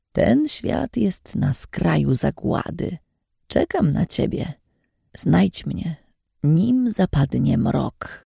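slow, hoarse, otherworldly warning; slow, rasping oracle in a trance; hoarse prophetic voice. Acted. Possessed old woman warns the girl, hoarse and slow. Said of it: "Jej głos był bardziej zachrypnięty niż zwykle".

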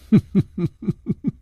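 short amused chuckle, rising; light laugh. A narrator's reading. Chu Liuxiang chuckles at his friend, light laugh. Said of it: "楚留香微笑着说".

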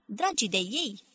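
ordinary delivery, nothing marked

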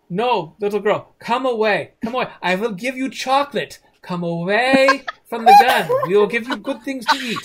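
high, loud hammed-up coaxing; pleading sing-out to a child, played for laughs. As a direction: lure a little girl away from danger. Men coax girl away from gun.

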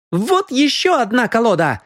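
high-pitched triumphant announcement; proclaiming. A narrator's reading. Мисс Киан produces the hidden deck, proclaiming. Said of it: "провозгласила она".

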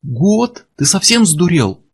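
high-pitched indignant question; outraged disbelief. A narrator's reading. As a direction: echo it back, appalled. Издатель возмущён названным сроком. (возмущённое изумление)

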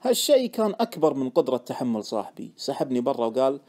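plain reading voice

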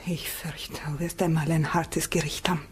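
soft, grave whisper; whispered warning. Acted. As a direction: warn him quietly of judgment.

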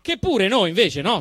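ordinary talk

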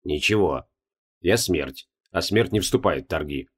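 low, cold, final voice; grim death voice. Acted. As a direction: pronounce it like doom. Death refusing goddess.

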